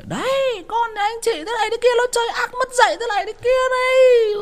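very high, loud, fast mock scolding shriek; screeching angry mother. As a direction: shriek like a furious market woman complaining. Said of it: "Chửi xong về". (giọng bà bán bún chửi the thé, rất cao và to)

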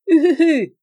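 loud, high feverish "hu-hu-hu" laugh; Jean-Marie's burst of laughter. A narrator's reading. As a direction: laugh out in high, voiced hoots. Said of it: "m'accueillit par un éclat de rire".

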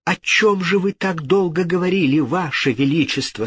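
high, ladylike mock wonder; feigned surprise. A narrator's reading. Lady questioning the king, feigning surprise. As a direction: ask high, faking astonishment. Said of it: "спросила дама с поддельным удивлением".

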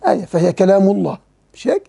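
ordinary delivery

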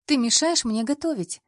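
high, loud indignant cry. A narrator's reading. Girlfriend scolding her boyfriend while cooking, indignant and loud. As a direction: snap at him in playful outrage. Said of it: "возмутилась я".

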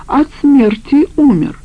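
high, drawn-out jeering cry; shrieking retort. A narrator's reading. Cornered woman taunts him about the death, shrieking retort. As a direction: fling it back high and slow. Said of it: "визжала она".

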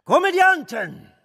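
a contemptuous cry of Komödianten, pitched high; scornful outburst. Acted. Minister mocking the actors, scornful loud cry. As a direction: spit the word out loud and high.

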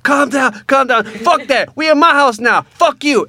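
high, loud screaming; furious shouting. Retold or imitated. Son screaming at the cop in his own house.

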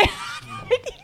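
squeaky amused giggle. Real, not acted. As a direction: burst into a high little laugh. Laughs after the jeer.

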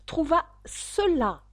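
repeating "Trouva cela" slowly to the duck with impatience; impatient. A narrator's reading. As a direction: stress "Trouva" with exasperation. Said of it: "répondit la souris avec impatience".

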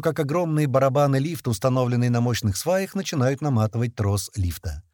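usual speaking voice

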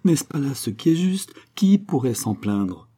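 high, emotional pleading; acted tremulous appeal, lightly voiced; trembling voice. A narrator's reading. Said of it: "s'écria-t-elle d'une voix tremblante".

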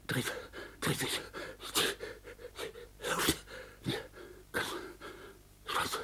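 soft, breathless whisper dying away; last gasped whisper, fading to nothing. Acted. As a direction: let the voice slip away as consciousness fades.

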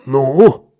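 loud one-word prod; insistent. A narrator's reading. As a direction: demand an answer sharply. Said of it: "настаивал Сол".

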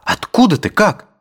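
surprised. A narrator's reading. Surprised friend reunion.

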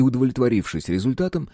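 ordinary delivery

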